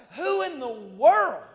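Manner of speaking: loud, slow, belted who in the world; outraged David. Acted. David ignoring brothers, shouting in outrage. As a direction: shout it across the valley. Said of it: "And David's like".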